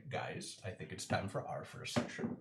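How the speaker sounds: low, soft announcement; solemn Gary. Acted. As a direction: announce the session gravely. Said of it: "then we'll say".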